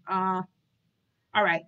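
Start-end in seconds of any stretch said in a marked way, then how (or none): none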